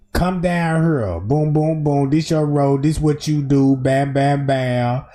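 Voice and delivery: low, even run-through of instructions; crew giving directions. Acted. Production company walking the cousin through her role, lightly acted low directing voice.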